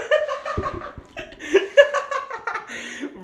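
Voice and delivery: long, high laughing fit; helpless laughter. Real, not acted. Streamer amused by quitting to the boss, helpless laughter. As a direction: crack up for real. Cracking up at own imitation.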